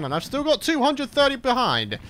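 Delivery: normal talking voice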